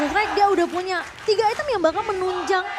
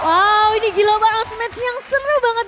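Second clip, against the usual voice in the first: excited; 5 semitones higher, 6 dB louder than usual. Real, not acted. Co-caster reacts to the match.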